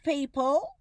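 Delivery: usual speaking voice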